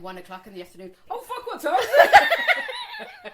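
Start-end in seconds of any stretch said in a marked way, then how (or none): 1.1-3.3 shrieking slow mock alarm, laughing; high laughing mock shock; mock panic. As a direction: yelp it out, half laughing, in fake horror. Mock panic at losing track.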